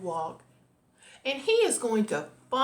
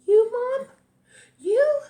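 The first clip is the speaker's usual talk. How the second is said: high, loud disbelief; incredulous young turtle, pitched way up; astonished; 14 semitones higher, 8 dB louder than usual. A narrator's reading. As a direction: squeak it in total surprise. Franklin astonished that mother worries.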